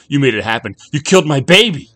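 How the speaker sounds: loud accusation climbing to a shout; furious accusation. A narrator's reading. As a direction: build to a high, enraged yell. Grieving father accuses the stranger.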